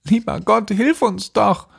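put-on high-pitched, pleading little girl; girl crying out. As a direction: plead for help in a high girlish voice. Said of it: "rief sie aus". (flehend ausrufendes Mädchen)

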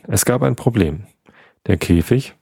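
ordinary delivery

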